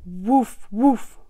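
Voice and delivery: two loud imitated woofs; dog bark. A narrator's reading. Storyteller as the dog calling to the spider, loud woofing. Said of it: "barked the dog".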